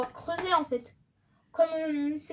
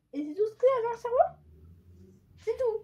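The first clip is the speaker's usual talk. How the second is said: surprised; 9 semitones higher, 3 dB louder than usual. Real, not acted. Surprised the brain holds little.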